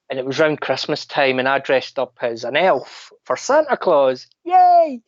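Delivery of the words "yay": much higher, loud cheery yay; mock elf cheer. Playing the elf for Santa, cheering. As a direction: cheer like a hyper Christmas elf. Recalling dressing as Christmas elf.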